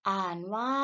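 usual speaking voice

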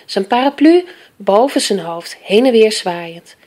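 usual speaking voice

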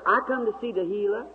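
high imitated Southern girl's voice; high Southern-accented plea, imitated; Southern talk. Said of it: "that Southern talk".

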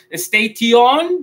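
"station" as "es-tei-ti-ON", T kept and E added; wrong Spanish-style pronunciation. Shown as a demonstration. Teacher tests learners with the mistake, mispronounced. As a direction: end "station" on a rising, long "ti-on". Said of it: "no vamos a decir station".

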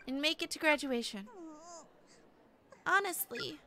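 high schoolgirl voice, impassioned lecture; high-voiced class rep giving a speech; earnest class rep. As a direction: plead with a classmate in a high, girlish voice.

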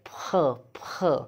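ph said between a puffed p and an f; ph and f mix. Shown as a demonstration. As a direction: blend ph halfway between a puff and an f. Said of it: "like a ph and f mix".

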